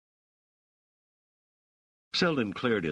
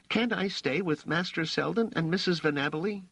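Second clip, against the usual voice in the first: put-on high-pitched kid's plea; pleading boy; 13 semitones higher than usual. A narrator's reading. Raich begs to stay with Selden, high pleading voice. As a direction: ask to stay in a small boy's voice. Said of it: "Raich said".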